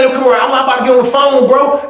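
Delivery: high-pitched, urgent exchange; calling across. Retold or imitated. Inmates calling to each other over the dispute, urgent. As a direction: pitch it up to carry across the room.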